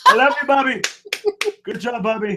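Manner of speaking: high-pitched, gushing presidential praise; hammed-up impression lavishing praise; Donald Trump. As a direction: cheer him on in a put-on politician's voice. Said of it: "[name] does Trump spot on".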